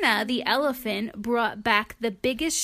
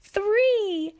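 rising, ever higher countdown to a loud "blast"; countdown shout; 13 semitones higher, 5 dB louder, much slower than usual. Acted. Sheila counting down her launch, rising to a shout. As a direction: build the countdown to a launch cry.

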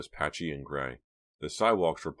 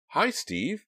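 bright greeting; 6 dB louder than usual. A narrator's reading. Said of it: "with a big smile".

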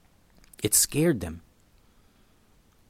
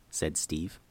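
ordinary delivery, nothing marked

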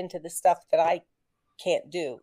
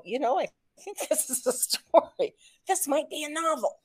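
amused realisation; 8 semitones higher, 3 dB quieter than usual. Retold or imitated. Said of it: "I look at it and go".

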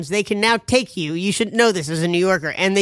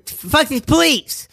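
much higher and slow, drawn-out defiant curse; mock angry outburst; 8 semitones higher, much slower than usual. Imitating angry New Yorkers about the cops, mock outburst. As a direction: play the fed-up New Yorker cursing the cops. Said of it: "the cops are".

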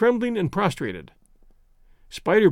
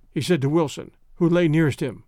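plain reading voice

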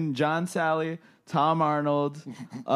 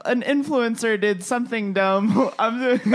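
mocking Jack; 6 semitones higher, 6 dB louder than usual. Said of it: "Jack's like".

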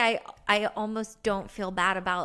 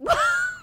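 high shrieking laugh; 7 dB louder than usual. Real, not acted.

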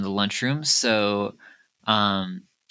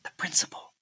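hushed, softer whispered answer; 5 dB quieter, faster than usual. Acted. Woman under the table answering, whispering. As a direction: whisper it like a secret. Hiding woman names whom she fears.

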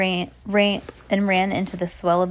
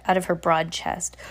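plain reading voice

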